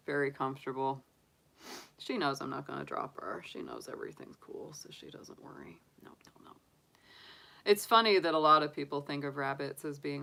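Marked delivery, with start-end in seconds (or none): 3.4-6.5 much softer soothing whisper. Real, not acted. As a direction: hush her gently. Whispering to calm bunny.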